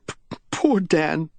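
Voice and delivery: high tiny voice, breaking on the stammer; a small, half-sobbing whisper of grief; tiny half-sob. A narrator's reading. Widow mourning her husband in a tiny voice, half sobbing. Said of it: "in a tiny voice, half sobbing".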